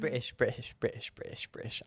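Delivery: quiet, fading repeated mutter; muttered accent warm-up. Shown as a demonstration. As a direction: rehearse under your breath. Warming up for an accent.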